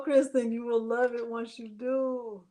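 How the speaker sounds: ordinary talk, nothing marked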